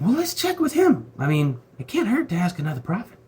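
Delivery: high squeaky king Ahab voice, hammed-up; a silly, high, whiny king asking around; mock king voice. King Ahab voiced in retold story.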